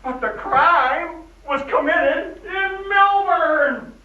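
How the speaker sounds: emphatic insistence. Acted. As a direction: draw out every word to make the point. Sheriff insists on where crime happened.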